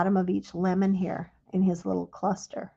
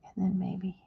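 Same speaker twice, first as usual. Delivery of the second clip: whisper; 8 dB quieter than usual. Real, not acted. Murmured aside while drawing.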